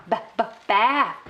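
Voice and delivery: "ba-, ba-, bath" slowed, the b repeated; B sound drill. Shown as a demonstration. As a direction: model the b in "bath" slowly. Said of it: "the B sound".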